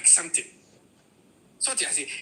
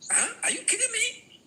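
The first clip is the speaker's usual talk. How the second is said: high, stunned disbelief; are you kidding me, pitched way up; incredulous. Real, not acted. Incredulous outburst.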